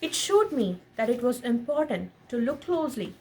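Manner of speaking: gentle storybook read-aloud; lightly animated picture-book narration; reading to a child. A narrator's reading. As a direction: read it like a bedtime story to your son. Reads picture book to son.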